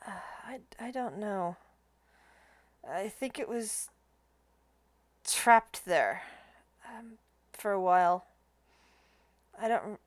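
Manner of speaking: soft, halting ghost soldier; hushed and unsure, with long searching pauses; whispering ghost. Acted. Ghost soldier recalls his last night for adventurers, hushed. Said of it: "a voice whisper".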